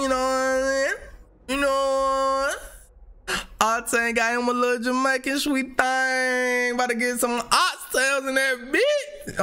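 high, hammed-up Jamaican accent; mock Jamaican accent. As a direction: tease in a badly faked Jamaican voice. Playful riff continuing the bad Jamaican.

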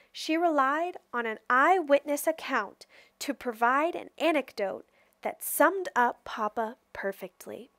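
plain reading voice

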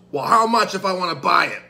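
acted insistence. Father demands to buy the farmer's turkey, insistent. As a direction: press the offer loudly.

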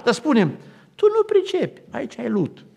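high retelling. Retold or imitated. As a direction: raise the voice high and let "lut" drop. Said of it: "Și iar întreba".

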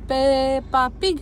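put-on slow, drawn-out model for a toddler; slow syllable-by-syllable prompt. Shown as a demonstration. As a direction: stretch Peppa Pig out syllable by syllable. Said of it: "Nu, zi lui mami".

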